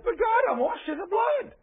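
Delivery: high-pitched pleading shout; acted desperate protest. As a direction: cry out in hammed-up protest to God. Sinner protesting at judgement, acted.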